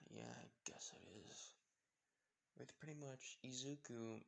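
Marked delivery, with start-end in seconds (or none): 0.1-1.5 soft, slow whispered reply. Acted. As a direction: breathe it out under your breath. Quiet reply to Deacon.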